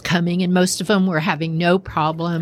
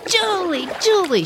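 high-pitched call across the water; 11 semitones higher than usual. Retold or imitated. Girls on the bridge call to Julie, high mock yell. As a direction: call her name over the river. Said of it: "we are all screaming".

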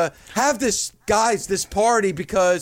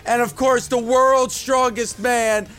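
announcer; 4 semitones higher than usual. Acted. Emcee opens the party show.

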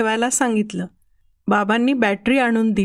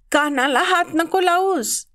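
mild yell; 6 semitones higher than usual. A narrator's reading. Son protects his sore ear, mild yell. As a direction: warn them off in a high voice. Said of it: "मी ओरडलो".

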